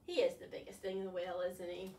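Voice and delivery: ordinary delivery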